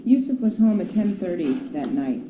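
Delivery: ordinary delivery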